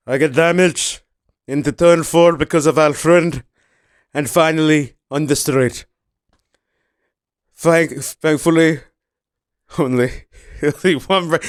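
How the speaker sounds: hint of a Spanish driver's radio complaint; mock Spanish-accented driver moaning about damage; Fernando Alonso impression. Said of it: "let's do Fernando Alonso".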